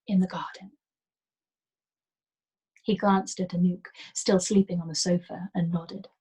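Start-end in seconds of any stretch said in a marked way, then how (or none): 0.1-0.8 gentle whisper; hushed, coaxing whisper; softly. Acted. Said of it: "I told him softly".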